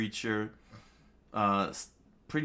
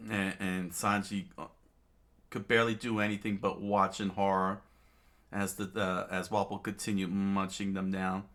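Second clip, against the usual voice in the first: usual speaking voice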